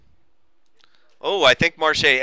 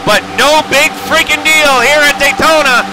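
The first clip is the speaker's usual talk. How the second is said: loud-and-clear, higher-pitched race call; live race play-by-play; 8 semitones higher, 5 dB louder than usual. Real, not acted.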